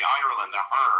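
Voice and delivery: the R in "Ireland" pronounced strongly; Irish R. Shown as a demonstration. Showing a listener the part heard when Irish people speak, pronounced Irish R. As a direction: lean on the R of "Ireland", then sound it alone as "r". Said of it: "that part that comes out of them".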